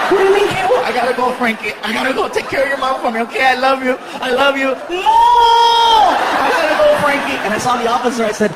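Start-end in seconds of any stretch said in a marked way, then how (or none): 0.1-0.8 put-on high, loud kid voice; alarmed little boy. Son alarmed by dad's news, high kid voice. 0.8-7.4 hammed-up desperate goodbye; mock-tragic farewell. Dad fakes his arrest to son, mock-desperate goodbye.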